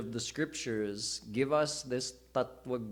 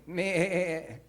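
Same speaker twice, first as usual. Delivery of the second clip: bleating sheep; 5 semitones higher than usual. Retold or imitated. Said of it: "that lion grew up going".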